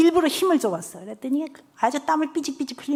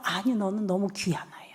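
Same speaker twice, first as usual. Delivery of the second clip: soft whisper; 4 semitones lower, 9 dB quieter than usual. Retold or imitated. Teacher reassuring child softly.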